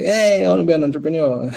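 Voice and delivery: mock youthful excitement; naive eager younger self. Imagined eager younger self quote.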